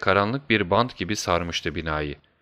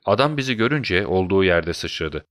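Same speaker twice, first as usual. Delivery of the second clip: ordinary delivery, nothing marked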